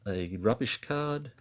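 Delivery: ordinary talk, nothing marked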